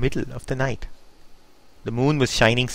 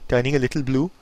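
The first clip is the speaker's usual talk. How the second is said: usual speaking voice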